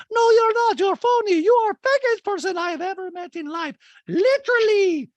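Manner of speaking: piercingly high, loud caricature; mock accusation. Host acts housewife calling another phony, high loud scolding. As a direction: rail at her in a hammed-up high voice. Acted quarrel between housewives.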